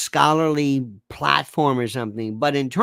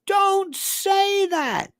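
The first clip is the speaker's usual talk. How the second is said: high, drawn-out yelled plea; hammed-up exasperated scolding, stretched right out; much slower than usual. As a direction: yell each word long and high. Cuts off the quoted phrasing again.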